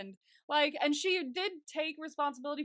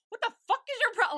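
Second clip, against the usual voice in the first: high, fast, louder outburst; indignant burst, pitched way up and rushed; angry; 12 semitones higher, 7 dB louder, faster than usual. Retold or imitated. Confronting paparazzi in imagination, angry. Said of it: "like, I get angry".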